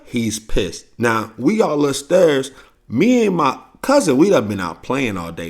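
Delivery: ordinary talk, nothing marked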